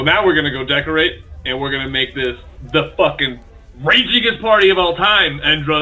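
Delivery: ordinary delivery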